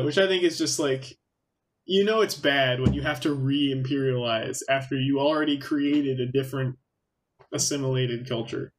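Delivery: usual speaking voice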